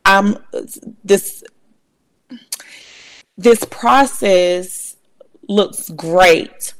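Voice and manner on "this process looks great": drawn out very slowly after a pause; slow and deliberate. Real, not acted. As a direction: weigh each word carefully. Slow emphatic restart.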